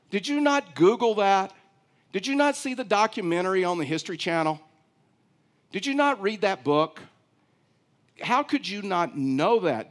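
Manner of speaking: a sneering stranger mocking someone for not knowing the news; condescending tone. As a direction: talk down to him like he's clueless. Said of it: "in a condescending tone says".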